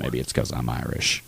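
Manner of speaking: a hint of Morgan Freeman's calm narration; Morgan Freeman impression. Cohost voicing a podcast guest in a lightly done Morgan Freeman voice. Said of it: "Morgan Freeman".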